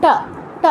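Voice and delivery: ট with the tongue curled to the roof; retroflex ta. Shown as a demonstration. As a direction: curl the tongue back for ট, then release. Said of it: "এর উচ্চারণ হবে হচ্ছে ট এর মতো".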